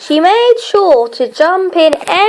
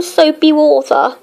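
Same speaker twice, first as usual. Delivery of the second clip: plain reading voice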